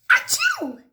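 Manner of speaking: high loud achoo; acted sneeze. Gerald sneezing again, loud high sneeze. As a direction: blast out the sneeze.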